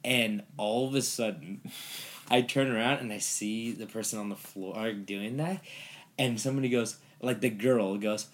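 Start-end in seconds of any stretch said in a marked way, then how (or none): none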